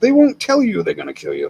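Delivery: normal talking voice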